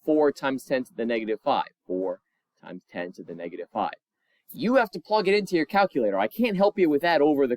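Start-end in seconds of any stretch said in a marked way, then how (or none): 1.9-3.9 a low, quick aside to yourself; quiet fast repeat. Shown as a demonstration. Teacher repeating the answer to students under their breath, quick and low. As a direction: drop much lower and softer, rattle it off quickly. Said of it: "restating the answer a second time".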